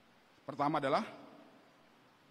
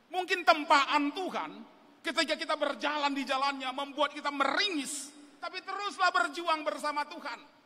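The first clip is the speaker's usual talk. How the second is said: raised, emphatic sermon voice; forceful preaching; 6 semitones higher, 3 dB louder than usual. Real, not acted. Preacher urges young people to keep struggling, forceful exhortation. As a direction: press each phrase home as an exhortation.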